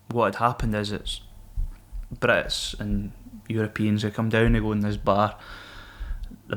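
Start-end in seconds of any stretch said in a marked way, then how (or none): none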